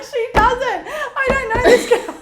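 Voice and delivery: high, loud retold denial, half-laughing; exasperated protest. Retold protest about fake publicist.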